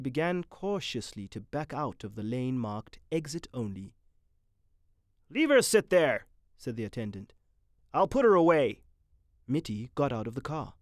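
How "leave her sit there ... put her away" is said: high, louder gruff attendant; brash attendant. A narrator's reading. Attendant ordering Mitty out of the car, brash. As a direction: talk down to him like a brash lot hand.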